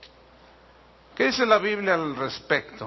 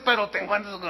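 ordinary talk

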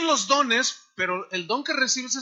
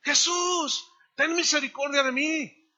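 high, drawn-out mock cry; acted calling out; 6 semitones higher than usual. Blind man starts yelling to Jesus, acted calling out. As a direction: cry for mercy in a raised pitch. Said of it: "comienza a gritarle a Jesús".

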